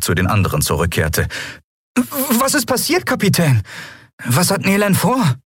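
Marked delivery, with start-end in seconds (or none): 2.4-5.4 put-on high, startled boyish questions; confused youngster. A narrator's reading. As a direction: ask in bewilderment, voice pitched high. Puzzled Miko asks the captain.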